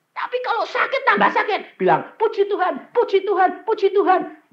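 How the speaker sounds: very high, loud exhortation; full-force preaching. Acted. Preacher urging the sick to praise God, high exhorting shout.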